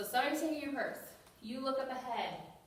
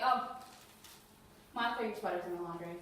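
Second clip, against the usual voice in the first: an offhand "oh", then dropping low; casual, unconcerned reassurance; dismissive; 6 semitones lower than usual. Shown as a demonstration. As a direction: brush off the worry with a low, matter-of-fact answer. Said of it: "You can't just say".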